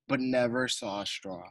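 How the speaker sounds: ordinary delivery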